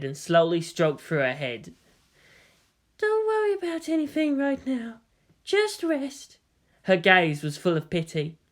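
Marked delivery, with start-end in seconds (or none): 3.0-6.4 a caring nurse checks on her patient; gentle nurse. Acted. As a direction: nurse asking an injured girl about pain. Nurse soothes the girl.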